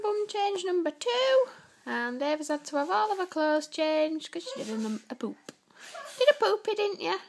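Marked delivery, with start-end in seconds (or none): 6.2-7.2 high loud sing-song. Real, not acted. Talking to baby.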